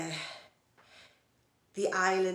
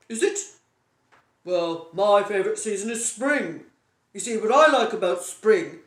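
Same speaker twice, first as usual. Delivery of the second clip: a louder, mock-hearty bloke hijacking the chat, laughing; self-absorbed fella; 10 dB louder than usual. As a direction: play the man who only talks about himself. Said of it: "most fellas would say".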